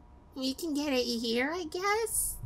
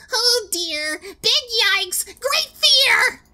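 high, loud hammed-up fear; scared horror game streamer; 11 semitones higher, 7 dB louder, much slower than usual. Real, not acted. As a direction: squeal out mock terror. Mock fear exclamations.

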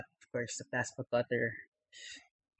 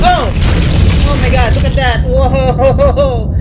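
high, loud shocked exclaim; excited shout; 9 semitones higher, 10 dB louder, slower than usual. Real, not acted. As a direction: blurt it out in shock. Reacting to gory death.

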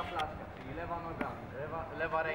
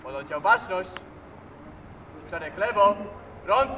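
drill command; 8 dB louder than usual. Real, not acted.

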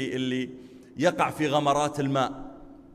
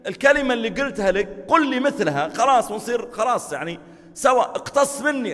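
urgent plea; 9 semitones higher, 8 dB louder than usual. Acted. Abu Bakr pleading with companion.

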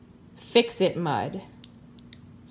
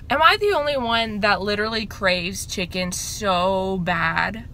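ordinary talk

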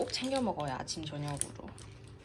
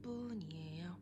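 soft hushed whisper; 2 semitones lower, 14 dB quieter than usual. Real, not acted. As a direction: whisper so nobody wakes. Early morning quiet vlog narration.

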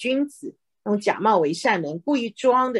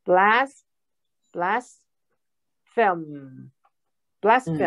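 blaspheme very slowly, split into BLAS and PHEME; teacher modelling "blaspheme" syllable by syllable; slow pronunciation demo; much slower than usual. Shown as a demonstration. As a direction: sound out "blaspheme" piece by piece with long pauses. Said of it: "这个发音的哈".

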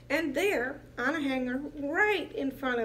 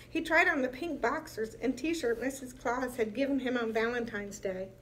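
plain reading voice

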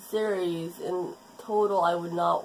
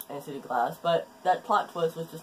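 ordinary talk, nothing marked

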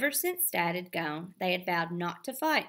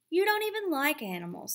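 higher, disbelieving jab; scoffing brother; 9 semitones higher, faster than usual. A narrator's reading. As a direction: brother scoffing at his sister. Evan scoffing.

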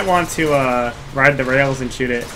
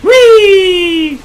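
very high, loud whee; playful glee; gleeful whee; 9 dB louder than usual. Real, not acted. Gleeful cry during ride.

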